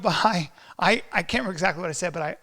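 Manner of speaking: ordinary talk, nothing marked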